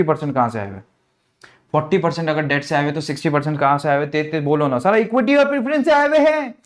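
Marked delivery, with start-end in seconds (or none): 4.8-6.5 pitched much higher like an eager pupil; eager student. Acted. Teacher mimicking a student's eager reply, much higher. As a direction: play the keen student blurting out the answer.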